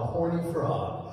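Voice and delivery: ordinary talk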